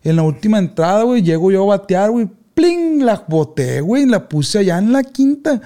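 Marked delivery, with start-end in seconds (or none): 2.5-3.0 high sharp pling of a bat hit; bat crack sound. Retold or imitated. Telling his friend about his game-winning hit, bat sound effect. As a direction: mimic the bat striking the ball. Imitating bat hitting ball.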